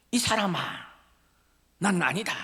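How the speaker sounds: high-pitched protest of innocence; indignant denial. Acted. Peter telling the second accuser he is mistaken, indignant. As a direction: deny it, pitch jumping up.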